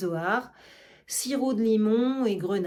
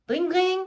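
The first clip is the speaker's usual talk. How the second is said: higher ringing "dring, dring" like a hand bell; bell imitation; 7 semitones higher than usual. Argan ringing for his servants, mimicking a bell. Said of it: "Il sonne une sonnette".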